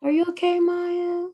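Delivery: put-on high, small child's worried voice; little niece. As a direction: imitate a sweet toddler checking in.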